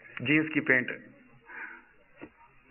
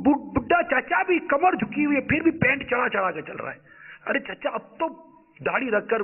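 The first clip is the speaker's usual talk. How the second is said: impassioned preaching; 7 semitones higher than usual. Acted. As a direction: cry out in dismay at the crowd. Preacher laments the generation.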